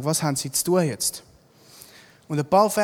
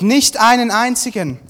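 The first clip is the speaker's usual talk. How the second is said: loud, raised reply; projected, higher-pitched answer; calling back; 5 semitones higher, 8 dB louder than usual. A narrator's reading. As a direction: call it back across the distance. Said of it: "riefen sie zurück".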